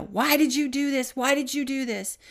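retold angry yelling. Retelling friend yelling in hospital bathroom, angry. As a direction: imitate a man yelling in anguish. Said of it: "he's yelling and literally pounding the wall".

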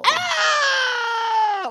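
very high imitation metal wail; attempted song scream. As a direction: wail it like someone dying, badly imitating the singer. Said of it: "that sounded nothing like it, but I tried".

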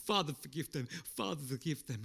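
hushed forgiveness; quiet plea. Retold or imitated. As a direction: answer the taunts with gentle prayer. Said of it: "He was like".

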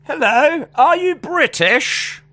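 high, slow hammed British accent; put-on British accent. Asking the flag-wearing lady, mock British accent. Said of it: "why am I putting an accent on".

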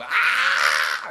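loud, low mock yell; imitated yell. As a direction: bellow like a man jolted awake. Carter wakes up screaming.

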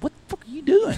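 high incredulous yell at his dad; retold outburst. Son scolding his dad for shooting the clients' bird, high outraged yell. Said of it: "I look at him, I said".

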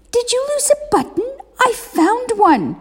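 tiny high whispery bird voice; sparrow voice. A narrator's reading. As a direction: sparrow politely interrupting. Sparrow offers a button.

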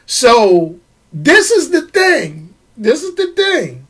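ordinary talk

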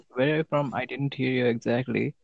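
usual speaking voice

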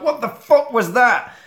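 high, loud shocked question; startled outburst. Acted. Men startled by a falling body, shocked outburst. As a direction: react startled, pitch jumping up.

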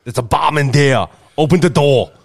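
a loose, punchy Arnold-style bark; Arnold Schwarzenegger voice. Retold or imitated. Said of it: "let's all take a look at Arnold".

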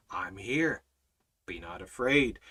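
low, calm answer to panic; reassuring Almighty Father. Acted. God answering worry over feeding them, low and calm. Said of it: "What does our, our Almighty Father say?".

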